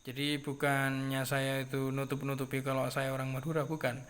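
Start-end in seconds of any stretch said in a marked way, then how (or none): none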